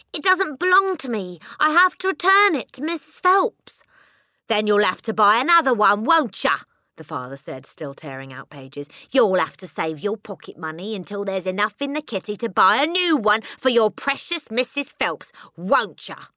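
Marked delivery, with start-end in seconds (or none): 0.1-3.8 high, loud pleading; desperate crying out. A narrator's reading. Matilda begging her father, high and loud. 4.5-6.6 loud, higher sneering taunt; loud mocking father. A narrator's reading. Father taunting Matilda, loud and sneering. 9.1-16.3 loud, higher sneering taunt; loud mocking father. A narrator's reading. Father taunting Matilda, loud and sneering.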